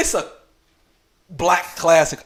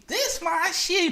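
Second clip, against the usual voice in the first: woman's voice; slower than usual. Retold or imitated. Woman claims the movie, voiced.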